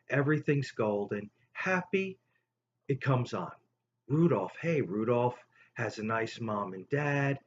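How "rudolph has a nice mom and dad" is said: usual speaking voice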